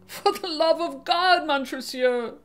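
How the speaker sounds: begging for his life; desperate plea. Acted.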